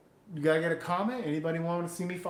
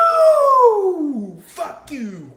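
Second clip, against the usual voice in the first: much higher, loud party yells; wild whooping; 13 semitones higher, 13 dB louder, much slower than usual. Real, not acted. Streamer cheering himself through a beer chug, whooping at full blast. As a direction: cheer yourself on while chugging.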